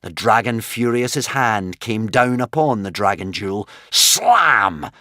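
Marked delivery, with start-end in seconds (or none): 3.9-4.9 slam. A narrator's reading.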